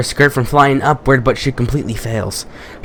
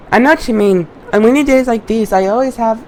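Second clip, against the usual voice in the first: a hint of a higher girl voice; lightly voiced schoolgirl; 9 semitones higher than usual. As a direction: read her lines a little higher, lightly. Voicing the girl character.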